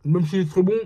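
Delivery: normal talking voice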